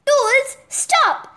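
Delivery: high, forceful command; ordering. A narrator's reading. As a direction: call a halt sharply. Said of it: "Manny ordered".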